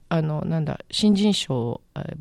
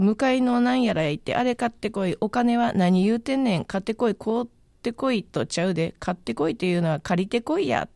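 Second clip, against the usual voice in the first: higher, lightly voiced Kyoto master giving errands in Kyoto dialect; 6 semitones higher than usual. The Kyoto master orders his apprentice about in a hint of Kyoto dialect. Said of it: "京都弁の難しさみたいなのを".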